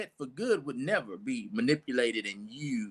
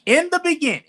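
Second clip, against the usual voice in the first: proclaimed scripture reading; 12 semitones higher, 11 dB louder, faster than usual. Shown as a demonstration. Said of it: "N I V".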